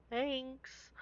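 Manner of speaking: very soft, high little thanks; awkward thanks. Retold or imitated. To the girl who lost, a very soft awkward thanks. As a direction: barely get it out, awkwardly.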